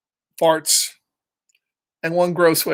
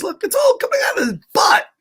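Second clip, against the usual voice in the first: excited; 14 semitones higher, faster than usual. Real, not acted. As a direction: burst out with glee at the picture. Teacher excited over picture.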